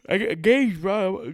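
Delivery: normal talking voice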